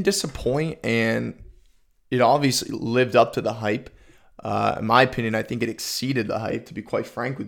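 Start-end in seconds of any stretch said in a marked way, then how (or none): none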